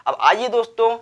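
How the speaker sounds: ordinary talk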